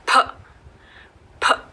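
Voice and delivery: फ as a breathy puff between प and फ; phonics p sound. Shown as a demonstration. Teacher showing the p sound with extra air. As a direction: puff out फ with lip pressure. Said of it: "प और फ की sound mix होती है".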